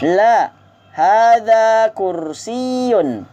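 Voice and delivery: slow, drawn-out Arabic drill phrase; slow model for repeating. Shown as a demonstration. Teacher models the phrase slowly for students to repeat. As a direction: spell out each syllable for the class to echo. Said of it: "drill model for repeating".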